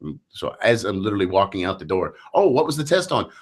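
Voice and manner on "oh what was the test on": father asking. Retold or imitated. Father quotes himself to daughter.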